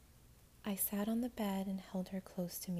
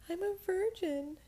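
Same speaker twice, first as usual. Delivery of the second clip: tearful confession; 12 semitones higher, 6 dB louder than usual. Acted.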